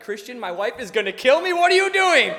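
high, loud, squeaky alarm; mock panic. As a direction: whine in comic panic. Panicked complaint to friend.

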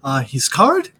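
chummy teammate, mildly put-on; a hint of a friendly teammate. Touchdown Tomas chats with Leonard, lightly voiced.

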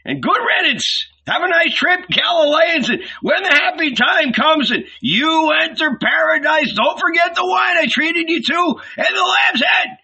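very high, loud innkeeper's holler; high-pitched shouting send-off; crying out. A narrator's reading. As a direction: yell a mocking farewell in a sky-high voice. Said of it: "he cried".

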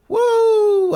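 a high, loud excited whoop; hype woo. Real, not acted. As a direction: whoop for the band. Hyped reaction to music.

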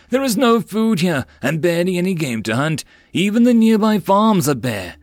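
high, loud pleading voice; pleading merchant. Acted. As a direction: plead to be believed.

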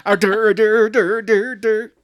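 put-on loud, high, laughing dopey drunk noises; mock drunk. Mocking the drunk dad with loud dopey noises. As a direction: ham up a drunk dad's babble.

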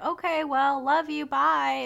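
mock casual dad hanging up; dad signing off. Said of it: "he's like".

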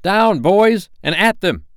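loud, high rallying cry; rally your troops into the charge; ringing battle call. A narrator's reading. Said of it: "Peter's voice rang out".

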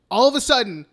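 ordinary delivery

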